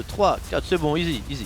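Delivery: normal talking voice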